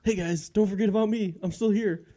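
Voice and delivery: high-pitched talking-dog voice; playful, high voice for the dog; dog's voice. Pretend. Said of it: "She's like".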